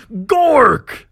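high, loud rival battle cry; orc yelling. Acted. Other orc brawler yelling with every swing, battle cry. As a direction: yell it back as a war cry. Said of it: "the other yelling".

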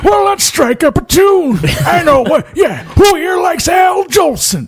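put-on rowdy old-timer shout. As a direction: bellow it across the saloon.

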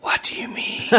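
teasing whisper; mock whisper. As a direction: whisper it back with deadpan teasing. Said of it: "whispering never comes across".

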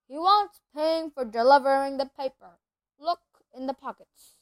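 big booming half-giant; rough country giant voice; gruff giant voice. Acted. Hagrid voiced, loud.

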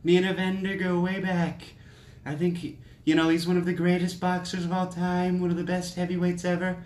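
put-on name-drop proudly like an old pal; Trump impression. Podcaster imitating Trump praising Holyfield, mock bragging. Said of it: "He was like".

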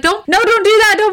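high, urgent pleading; panicked plea. Retold or imitated. James panicking as her horse bolts, high urgent plea. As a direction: beg her to stop, alarmed. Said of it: "And he's like".

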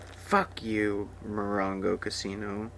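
usual speaking voice

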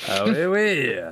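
light mock French accent. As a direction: play a silly Frenchman saying oui oui. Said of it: "we are French now".

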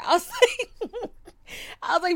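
laughing through the words, high and loud. Real, not acted. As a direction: crack up while saying it. Laughs mid-line.

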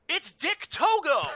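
high, slow surprised callout; excited shout. Retold or imitated. Alvarez spotting the wrestler live on the podcast. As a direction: yell the name in shock. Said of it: "Alvarez just goes".

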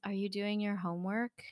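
softly, as if into someone's ear; retold whisper. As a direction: imitate a stranger's hushed question. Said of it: "he whispers in my ear".

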